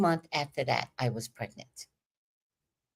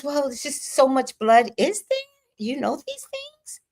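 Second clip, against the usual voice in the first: mock whiny husband; 8 semitones higher, 3 dB louder than usual. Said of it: "He goes".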